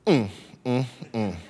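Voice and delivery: low, soft knowing "mm, mm, mm"; a gloating tsk-like hum, dropped low; smug sister hum. Retold or imitated. Sister taunting her little brother with a low hum. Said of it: "she makes this sound".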